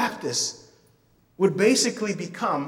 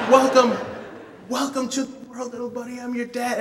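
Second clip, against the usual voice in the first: tender welcome; 6 semitones higher than usual. Retold or imitated. Father welcoming newborn son.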